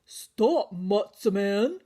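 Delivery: put-on loud old grandpa call; crying out. Grandpa Solly crying out after the matzah man. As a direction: call him back. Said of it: "cried Grandpa Solly".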